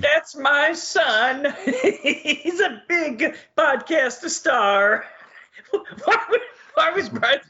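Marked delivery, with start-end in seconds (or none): 0.0-5.0 drawn-out, higher Italian mama voice; proud mother in a hammy, slow Italian accent. Acted. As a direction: stretch it out in mock Italian, higher and laughing. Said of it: "have an Italian accent".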